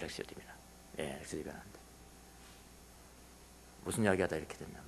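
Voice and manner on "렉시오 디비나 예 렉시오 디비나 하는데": soft repeat. Shown as a demonstration. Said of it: "렉시오 디비나 하는 거예요".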